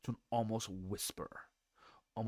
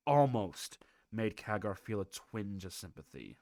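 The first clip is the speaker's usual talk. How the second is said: ordinary delivery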